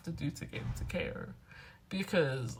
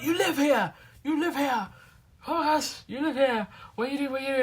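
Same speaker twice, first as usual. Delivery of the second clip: higher and louder, gushing repeats; imitating excited strangers; 7 semitones higher, 4 dB louder than usual. Strangers who think she's famous, imitated excited gushing. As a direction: squeal it like a starstruck fan. Strangers amazed she lives there.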